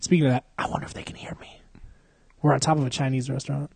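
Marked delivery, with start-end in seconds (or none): 0.6-1.6 fast hushed whisper; quick real whisper. Real, not acted. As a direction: whisper so they can't hear downstairs. Worries the restaurant below hears.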